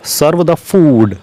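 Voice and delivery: each word spaced out slowly for the class; slow dictation. Shown as a demonstration. Teacher spelling out an English sentence for learners, slow and deliberate. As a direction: dictate it slowly so students can follow. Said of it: "slow dictation of English phrase".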